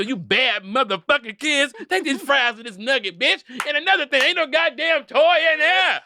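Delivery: put-on cuss out the kids at the window; hammed-up angry server; cussing drive-thru worker. Fast-food worker cussing kids.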